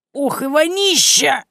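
high, slow groan of disgust. Acted. Chebantseva complains of the stink, high and slow. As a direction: drag out the complaint about the smell.

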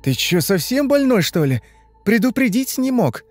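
high-pitched indignant questions; stern scolding. A narrator's reading. Sister scolds her unannounced brother, stern and indignant. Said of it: "похолодевшим и посуровевшим тоном".

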